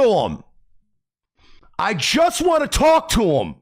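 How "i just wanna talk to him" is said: high yelled plea, much higher; desperate shout. Shown as a demonstration. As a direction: yell it like you're held back. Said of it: "repeating line in varied takes".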